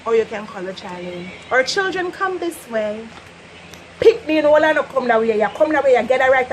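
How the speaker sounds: normal talking voice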